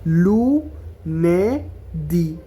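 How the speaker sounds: very slow broken syllables; লুনেদি split into slow syllables. Shown as a demonstration. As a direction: say লুনেদি as lu-ne-di, slowly. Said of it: "এভাবে syllable ভাঙা ভাঙা".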